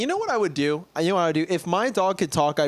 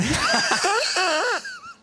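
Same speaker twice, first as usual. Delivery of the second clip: real high, helpless laughter; high cackling laugh. Real, not acted. Friend cracking up at a silly question, helpless laughter. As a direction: burst out laughing, pitched way up.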